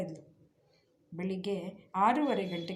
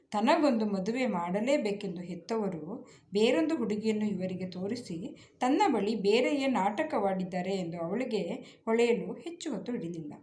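ordinary delivery

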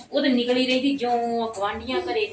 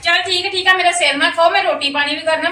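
higher, louder, fed-up outburst; wife shouts at her nagging husband to stop; exasperated shout; 7 semitones higher, 5 dB louder than usual. Acted. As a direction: cut him off, done with the argument. Wife ends quarrel with husband.

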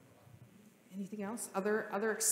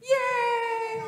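very high, loud squeal of joy; excited yay; 9 dB louder than usual. Shown as a demonstration. Pastor showing children how to cheer, high squeal. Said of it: "things we say when we're excited".

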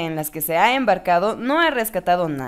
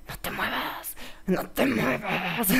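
hushed little-voice whisper, drawn out; susurro; 9 dB quieter, slower than usual. Acted. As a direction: whisper it as a soft, creepy warning. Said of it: "ah, susurra". (susurro de vocecilla)

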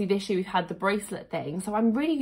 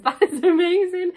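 higher, louder laughing exclamation; excited laugh; 9 semitones higher, 7 dB louder than usual. Real, not acted. Too amazed to get over the hourglass, loud laughing gush.

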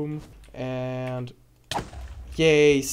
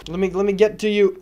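ordinary talk

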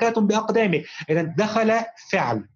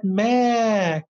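"ما" held long on a raised pitch; stretched long vowel; 4 semitones higher than usual. Shown as a demonstration. Teacher demonstrates madd to pupil, "ما" drawn out high. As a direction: sustain the aa of "ما", higher and slow. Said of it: "بها مد بالألف".

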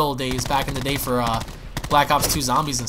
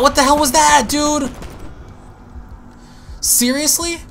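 shocked outburst; 9 semitones higher than usual. Real, not acted. Streamer reacting to sudden death, shocked shout. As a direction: blurt it out in real disbelief.

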